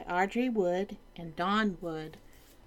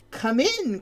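jolly king; 6 semitones higher, 5 dB louder than usual. Acted. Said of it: "cried the king".

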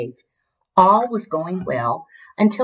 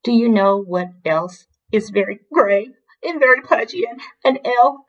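higher, tearful, breaking off mid-word; weepy wail; 6 semitones higher than usual. A narrator's reading. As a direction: get choked by tears before the last word. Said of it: "The weeps took over".

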